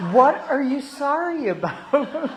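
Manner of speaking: incredulous laugh at the apology; laughing disbelief. Retold or imitated. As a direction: boss can't understand the apology, laughing in disbelief. Puzzled boss asks again.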